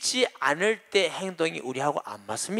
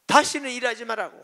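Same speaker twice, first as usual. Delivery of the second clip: higher, pressing 아들로 hard in a mock rebuke; angry insistence; 8 semitones higher than usual. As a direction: drive it home on 아들로, indignant. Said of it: "화를 냈어요".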